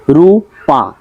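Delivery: রূপা split as রূ, পা; two separate beats on রূপা; syllable by syllable. Shown as a demonstration. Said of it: "দুইটা দেওয়া আছে".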